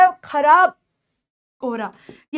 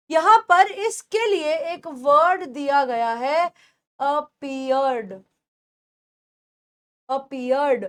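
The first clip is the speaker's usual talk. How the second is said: ordinary talk, nothing marked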